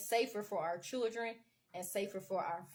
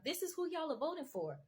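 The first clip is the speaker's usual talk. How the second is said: ordinary delivery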